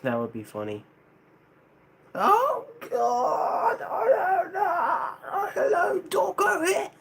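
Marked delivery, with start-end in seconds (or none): 2.1-6.9 very high wailing mock cry; loud, squealed, hammed-up sobbing voice; crying [name]. Answering a chat request for [name] crying, mock wailing. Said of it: "Do you see [name] cry when?".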